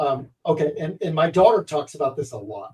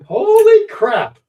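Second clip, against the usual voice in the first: much higher, louder and slow; real surprise; 8 dB louder, much slower than usual. Real, not acted. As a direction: stretch out the astonishment. Learns they lived same town.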